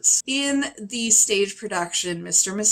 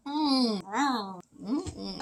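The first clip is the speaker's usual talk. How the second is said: soft mock-sultry "mm" noises; hushed, suggestive mmms; sensual humming; 2 semitones higher, 7 dB quieter, slower than usual. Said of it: "She's a sensual character".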